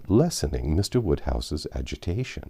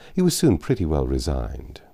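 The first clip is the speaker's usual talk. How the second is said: ordinary delivery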